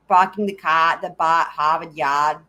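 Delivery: dropped r's, broad Boston vowels; Boston accent. Retold or imitated. Viewer's Boston accent quoted back on stream, dropped r's. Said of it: "doing your accent in the Discord".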